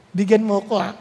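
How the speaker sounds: ordinary talk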